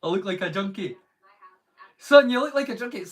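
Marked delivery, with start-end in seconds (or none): 2.0-3.0 mum telling off her son, higher and louder; a mock-scolding mother, quick; mum's scolding. Mum scolds her son about his missing tooth, higher and louder. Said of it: "my mum complains".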